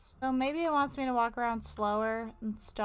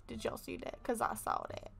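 whispering; 8 dB quieter than usual. Real, not acted. Creeped out in horror game.

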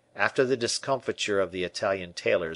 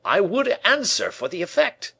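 high, loud assurance; eager cry; 12 semitones higher, 5 dB louder than usual. A narrator's reading. Painter vouches for the effect, crying out. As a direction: exclaim it in a high voice. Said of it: "cried Lebrun".

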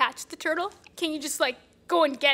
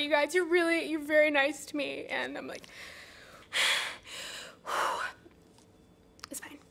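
ordinary talk, nothing marked

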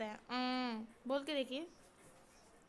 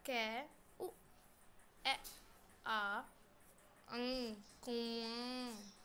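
कुआं drawn out with the nasal आं; slow spelling; 5 dB quieter, much slower than usual. Shown as a demonstration. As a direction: build कुआं slowly, sound by sound. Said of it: "spelling out the word". (कुआं धीरे धीरे, नाक से आं)

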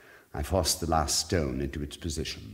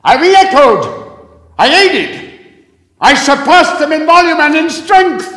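much higher, louder shouting; yelling back; 20 dB louder, slower than usual. A narrator's reading. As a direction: outshout the screaming prisoner. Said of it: "I replied to the yells".

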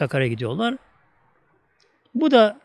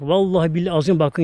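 normal talking voice

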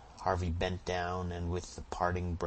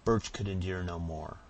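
plain reading voice

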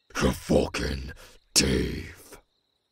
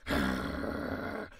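ordinary talk

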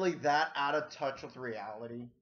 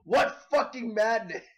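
louder mock-aggrieved whine of protest; mock outrage; 3 semitones higher, 4 dB louder than usual. Reactor mimicking an artist complaining about being demonized, mock outrage. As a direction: imitate indignation, building to a louder finish. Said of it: "with a straight face, like".

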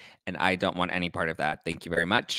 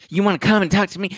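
high, loud, fast mock challenge; hammed-up rapid-fire outrage; going off; 10 semitones higher, 7 dB louder, faster than usual. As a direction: imitate a drunk picking a fight. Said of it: "And he's going off".

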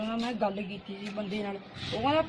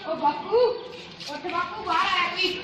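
raised, higher call to father indoors; 8 semitones higher, 3 dB louder than usual. Acted. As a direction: call him out of the house. Son calls father from inside.

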